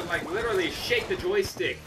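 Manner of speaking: usual speaking voice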